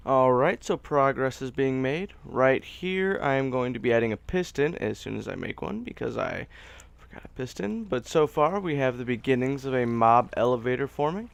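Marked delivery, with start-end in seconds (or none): none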